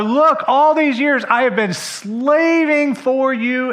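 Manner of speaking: high, loud, slow grievance; bitter outburst. Acted. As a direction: throw the years of work in his face. Older son's bitter complaint.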